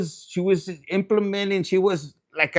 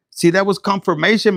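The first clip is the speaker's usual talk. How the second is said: usual speaking voice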